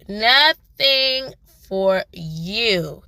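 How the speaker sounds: very slow, every word stretched; drawn-out repeat. Real, not acted. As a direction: stretch it out to make the point.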